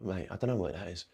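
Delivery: very soft, low, dopey dog voice; voicing the dog. Coach voicing a confused dog that can't understand the TV, dopey murmur. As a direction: mumble like a baffled dog. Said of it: "He'd just fucking look at me".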